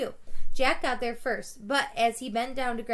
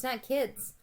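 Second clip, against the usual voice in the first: usual speaking voice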